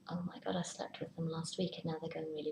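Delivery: hurried, hushed worried whisper; anxious whisper. Pretend. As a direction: confide the panic under your breath. Anxious thoughts after partner distances.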